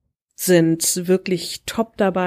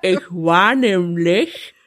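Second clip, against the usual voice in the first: talking around a cork; muffled speech with a cork held between teeth; cork in mouth; slower than usual. Real, not acted. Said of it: "dass ich mit einem Korken im Mund spreche".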